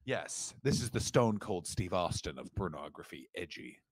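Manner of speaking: low, very soft reverent voice; hushed as if a great discovery; mock solemn awe.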